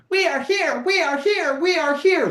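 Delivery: high, loud chanted rally cry. Real, not acted. Host rallying listeners that the positive side is winning, loud chant.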